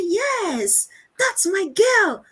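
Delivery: high, drawn-out proud praise; delighted cheering. Retold or imitated. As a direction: cheer your girl on, slow and high. Acts mother cheering her daughter.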